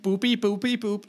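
high, bouncy beep-boop jingle; mock boop jingle. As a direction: chirp it like a silly game-show sting. Joins jingle for contest.

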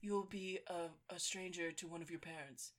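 hushed movie-quote solemnity; film father. Acted. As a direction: deliver a quiet, grave verdict. Quotes father from Pride and Prejudice.

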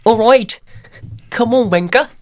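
high mock English accent. Shown as a demonstration. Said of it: "je hebt ook een goed, eh, Engels accent".

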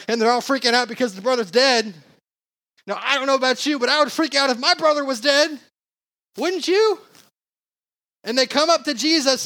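high-pitched, animated sermon storytelling; worked-up preacher retelling a Bible story; animated preaching. Real, not acted.

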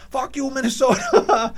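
high, slow, loud taunt; retold defiant yell. Chicago rival running at the wall, high mock yell. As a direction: imitate a rival calling out as he charges. Rival calls out while charging.

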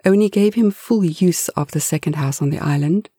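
plain reading voice

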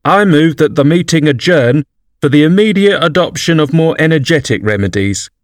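a pompous bird proposing a motion; solemn dodo. A narrator's reading. Dodo's formal motion.

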